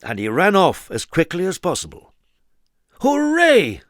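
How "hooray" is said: high, loud crowd cheer. A narrator's reading. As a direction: cheer with joy. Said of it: "cheered the crowd".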